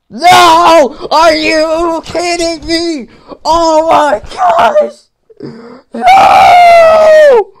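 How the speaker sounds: very high, loud wails, some drawn out slowly; anguished screaming. Real, not acted. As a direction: scream in disbelief at the game. Falls far back, screaming.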